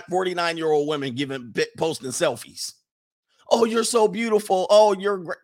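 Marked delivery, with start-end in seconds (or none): 3.5-5.4 higher, louder fawning commenter; mock gushing compliments. Commenters flattering a woman's selfie, mock gushing. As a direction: gush over a selfie, hammed-up.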